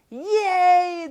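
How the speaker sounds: a high, loud cheer; cheering crowd. A narrator's reading. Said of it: "the crowd cheered".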